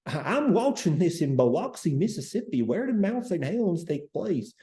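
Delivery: put-on high, lilting Biloxi, Mississippi accent. Livestream host playing a puzzled viewer in Biloxi, Biloxi accent. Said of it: "that was a good Biloxi accent".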